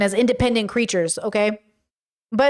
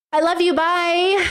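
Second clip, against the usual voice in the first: rehearsed sign-off; 5 semitones higher, slower than usual. Shown as a demonstration. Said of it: "I practiced that so good".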